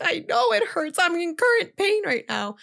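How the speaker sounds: snapping back at sympathetic students while hurt; exasperated pain. Retold or imitated. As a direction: whine it out through the pain. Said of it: "And I'm over here like".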